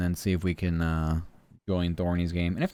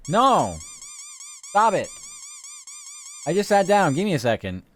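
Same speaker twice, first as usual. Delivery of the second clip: shouting; 6 dB louder than usual. Real, not acted. Yelling at game attacker.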